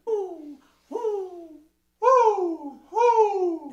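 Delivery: sharp high loud hoo from the belly; breath pulse from the belly. Shown as a demonstration. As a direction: pump a quick hoo from the belly. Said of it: "from the belly button".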